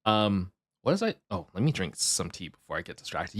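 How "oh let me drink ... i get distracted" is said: normal talking voice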